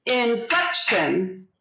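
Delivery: INFLECTION drawn out in three slow beats; syllable by syllable. Shown as a demonstration. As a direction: say INFLECTION slowly, as in-flec-tion. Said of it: "So it's three syllables".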